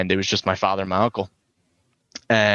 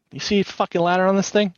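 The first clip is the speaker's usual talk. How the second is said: higher-pitched smart-aleck question; retold wisecrack; 9 semitones higher than usual. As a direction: imitate an uncle sassing a cop. Repeats uncle's wisecrack to cop.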